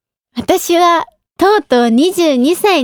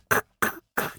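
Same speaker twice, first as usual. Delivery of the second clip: voiceless inhaled chuckle; unvoiced kuk-kuk laugh; breathy suck-in laugh; 14 dB quieter, much faster than usual. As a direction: imitate a stifled gasping laugh. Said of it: "と笑います".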